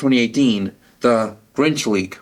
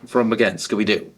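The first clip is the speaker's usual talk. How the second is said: ordinary talk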